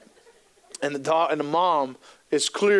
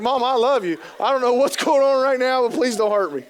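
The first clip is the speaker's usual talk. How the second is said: put-on higher, louder pleading kid voice; scared little kid; 7 semitones higher, 4 dB louder than usual. Confused kid begging his mom, high frightened plea. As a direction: plead like a bewildered little boy.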